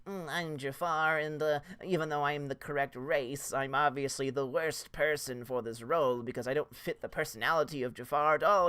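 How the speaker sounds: soft, breathy, wimpy villain voice; mock weak Jafar. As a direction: play Jafar as a limp whispering wimp. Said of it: "that's what I heard was".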